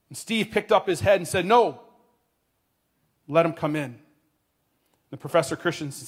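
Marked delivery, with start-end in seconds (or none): 1.4-4.0 firm objection. A narrator's reading. Steve objects to the students' shout.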